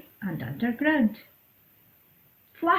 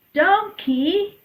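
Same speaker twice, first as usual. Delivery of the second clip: calling out; 11 semitones higher, 7 dB louder than usual. Acted. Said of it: "Goat called".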